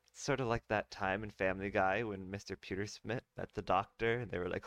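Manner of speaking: plain reading voice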